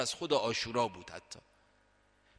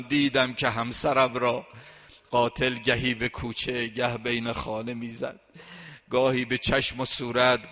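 mourning lament; 9 dB louder than usual. Acted. Preacher lamenting Fatima's beating to the mourners: loud keening. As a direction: pour out the grief at full force. Lamenting elegy at full force.

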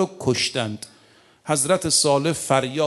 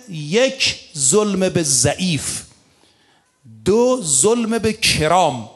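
slow emphasis; much slower than usual. Real, not acted.

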